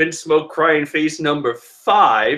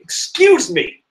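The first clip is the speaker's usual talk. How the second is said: much higher, slow and offended "Excuse me."; indignant; 3 dB louder, slower than usual. Real, not acted. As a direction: call them out, mock outrage. Indignant correction.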